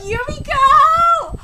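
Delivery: loud, long drawn-out cry of the name; high, loud fan reaction; excited exclamation. Real, not acted. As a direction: howl the name, stretching it.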